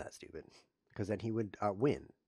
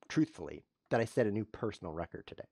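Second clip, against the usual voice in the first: normal talking voice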